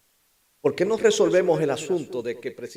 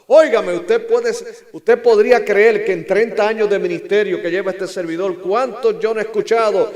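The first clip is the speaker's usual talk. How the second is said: raised, high pulpit voice; full-force preaching; 8 semitones higher, 10 dB louder than usual. Acted. Preacher recalls thirty years of ministry, full-force preaching. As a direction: challenge the congregation loudly.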